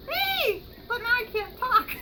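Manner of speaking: normal talking voice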